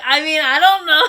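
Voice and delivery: laughing nervously through the answer. Real, not acted. Daughter dodging her mom's question, nervous laugh.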